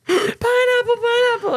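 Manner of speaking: high, hammed-up begging; mock pleading. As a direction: squeal the safe word in pretend panic. Play-acting begging with safe word.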